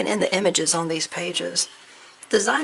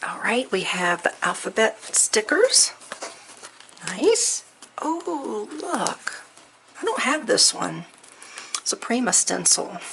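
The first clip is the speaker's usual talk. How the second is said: usual speaking voice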